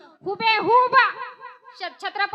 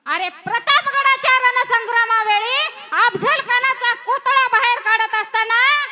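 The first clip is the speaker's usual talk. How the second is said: loud, higher, urgent declaration; dramatic realization; 5 semitones higher, 7 dB louder than usual. Acted. Fazal Khan remembering Pratapgad, crying out loudly. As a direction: burst out as the memory hits.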